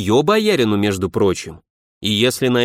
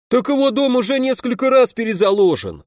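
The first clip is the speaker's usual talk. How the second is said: much higher shouted heckle from the crowd; heckling shout; 11 semitones higher than usual. A narrator's reading. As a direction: call out from the back of the hall. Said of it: "раздался выкрик из зала". (выкрик из зала, высоким голосом)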